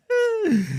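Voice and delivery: short high laugh. Real, not acted. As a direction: cackle briefly.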